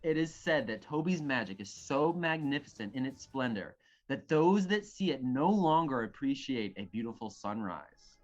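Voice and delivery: dramatic bardic tale-telling; storytelling narration. Acted. Reading the play's opening fight to friends, dramatic narration. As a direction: narrate an epic battle tale, weighing each phrase.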